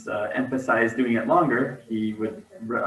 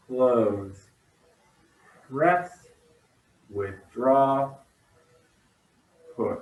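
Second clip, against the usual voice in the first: serene tai chi cues; much slower than usual. Acted. Calling tai chi cues slowly.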